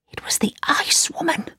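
breathy dramatic whisper. A narrator's reading. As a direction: whisper the reveal, hushed. Dramatic reveal of Ice Woman.

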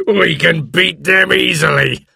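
high, slow gloating pirate voice; gloat, still full of laughter; laughing. A narrator's reading. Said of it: "laughed another pirate".

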